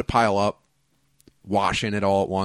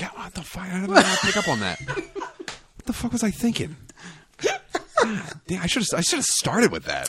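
a mock designer, shocked at his own mistake, laughing; panicked designer; 9 semitones higher, slower than usual. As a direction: talk to yourself in dismay with a laugh.

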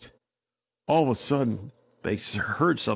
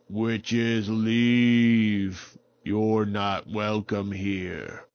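very slow, drawn-out booming warning; tree voice; much slower than usual. Acted. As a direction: speak like an ancient living tree. Tree voice banishes witches.

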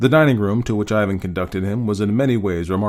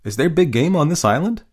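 surprised; 7 semitones higher, 3 dB louder than usual. Acted. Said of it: "Rainsford expressed his surprise".

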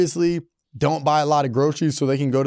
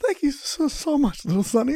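put-on high, soft sweet old lady; 11 semitones higher, 3 dB quieter than usual. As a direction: thank him like a frail grandma. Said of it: "she was like".

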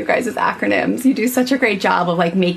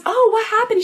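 high gentle mom voice. Retold or imitated.

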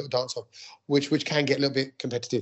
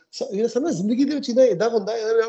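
lightly voiced, higher, fast slip into Punjabi mid-sentence; sudden switch into Punjabi; 7 semitones higher than usual. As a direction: mimic a friend drifting into Punjabi for no reason. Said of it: "why we switch?".

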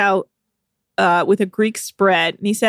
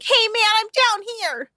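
much higher, tiny little-man voice; tiny Glenn voice; 12 semitones higher than usual. Acted. Joking about short Glenn, tiny squeaky voice. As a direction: squeak it from far below. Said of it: "his voice just sounds tinier".

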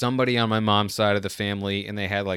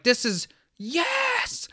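much higher, slow, stretched cheer; thrilled kid; much slower than usual. Retold or imitated. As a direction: let the yes burst out in pure joy. Kid loving the swerving ride.

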